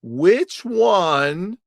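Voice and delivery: slow, deliberate comparison with long pauses; drawn-out quiz question; slow, emphatic. Shown as a demonstration. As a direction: lay it out slowly, piece by piece. Spelling out the math for viewers.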